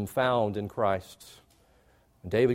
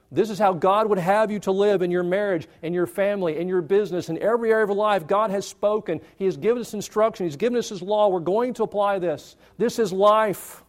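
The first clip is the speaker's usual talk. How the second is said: raised, driving sermon voice; full-force preaching; 6 semitones higher than usual. Acted. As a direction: push each line home to the congregation.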